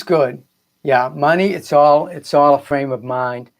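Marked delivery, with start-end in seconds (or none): none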